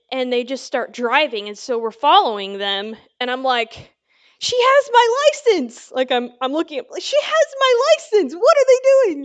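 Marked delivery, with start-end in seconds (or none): 4.4-5.9 high, loud, upset cry; alarmed protest. Retold or imitated. Watching the police drive off with her license, upset. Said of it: "I'm still mad". 7.0-9.2 high, loud, upset cry; alarmed protest. Retold or imitated. Watching the police drive off with her license, upset. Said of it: "I'm still mad".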